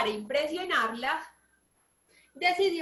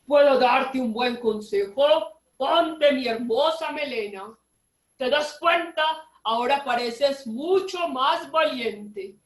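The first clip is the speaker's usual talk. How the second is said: loud, slow, grand lion voice; 6 dB louder, slower than usual. A narrator's reading. The lion offers Don Chancho his mane, loud and slow. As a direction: give advice like a proud lion. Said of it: "le dijo".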